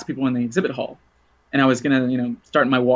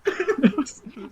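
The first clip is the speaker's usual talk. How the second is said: high laugh; 13 semitones higher, 4 dB quieter than usual. Real, not acted. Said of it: "it was really funny".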